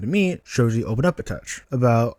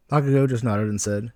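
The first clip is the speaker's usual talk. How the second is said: usual speaking voice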